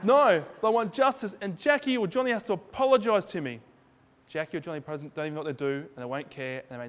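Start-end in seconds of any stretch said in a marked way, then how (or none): 0.0-3.6 a believer protesting to God, indignant and loud; indignant demand. Retold or imitated. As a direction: demand it like a wronged child. Adult demanding justice from God.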